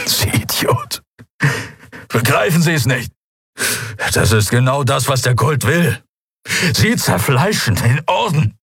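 strained high outburst from a hurt man; weakened, agitated prisoner. Acted. Prisoner telling the commodore he plays into the cult's hands, strained. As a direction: start on a rasp and rise in desperation. Telios rails at Monaro from cell.

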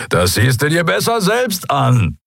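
high, calling, a touch amused; incredulous amusement. A narrator's reading. Skria calling the others to look, incredulously amused. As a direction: call back in amused disbelief. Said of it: "Der Skria klang ungläubig amüsiert".

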